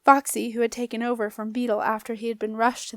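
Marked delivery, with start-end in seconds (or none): none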